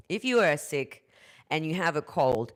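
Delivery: ordinary talk, nothing marked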